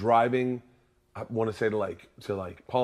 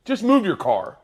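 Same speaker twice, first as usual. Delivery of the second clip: much higher, louder mock exasperation; exasperated cop; 10 semitones higher, 6 dB louder than usual. Cop dismissing the driver, mock fed-up. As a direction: give up on him like a fed-up cop. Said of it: "The cop came up very angry".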